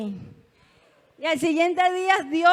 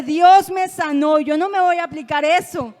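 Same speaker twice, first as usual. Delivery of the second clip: declaración firme; 7 dB louder than usual. Retold or imitated. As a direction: stand your ground loudly. Se planta y declara su sanidad. (declaración de fe en voz alta)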